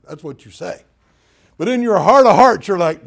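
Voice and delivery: ordinary talk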